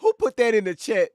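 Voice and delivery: high, loud, startled question; surprised outburst. Real, not acted. Streamer startled by a chat message, high and loud. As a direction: blurt it out at the chat.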